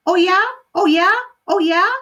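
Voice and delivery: a cheeky bird taunting the mother; high parrot voice, sassy. Acted. Parrot talks back, repeating.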